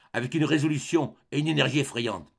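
ordinary delivery, nothing marked

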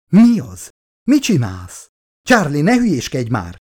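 kétségbeesett, magasabb és hangosabb kiáltás; desperate alarm. A narrator's reading. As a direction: riadtan próbálja megállítani a férfit. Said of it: "ugrott oda a nő kétségbeesve". (Elizabeth kétségbeesetten szól rá Charlie-ra)

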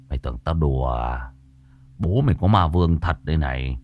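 put-on stern young master. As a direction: boast low and sure of himself. (giọng thầy trẻ trầm, ngạo nghễ)

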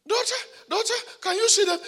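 high coaxing call to the daughter; mild high coaxing; pleading call. Retold or imitated. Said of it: "And I said".

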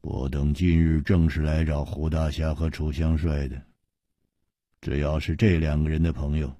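low, cold, measured threat; cold sinister voice. Acted. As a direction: menace them quietly. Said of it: "带着哭脸的人说".

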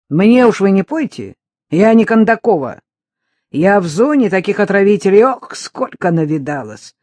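громко, напористо, выше обычного; отрежь с бывалой уверенностью; горячее возмущение. A narrator's reading. Любка спорит, кипит. (задиристая баба, во весь голос)

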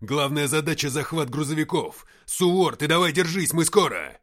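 loud, urgent radio report; shouted briefing. Acted. Ignat briefing the captain over radio, shouting. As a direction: call out intel over the noise.

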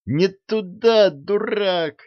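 high, slow yelling; вопль. A narrator's reading. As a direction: yell it in panic. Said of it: "завопил принц". (вопль принца)

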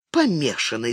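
ordinary delivery, nothing marked

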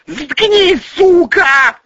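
very high, loud screech; furious shriek. Acted. Julia silences the woman telling on her, shrieking. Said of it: "взвизгнула Юлия Сергеевна".

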